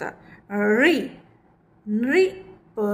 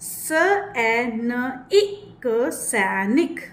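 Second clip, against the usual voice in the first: very slow sounding out; slow spelling out; much slower than usual. Shown as a demonstration. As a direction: spell out each letter, then the word. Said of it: "मात्रा को किस तरह से stress दे रही हूँ".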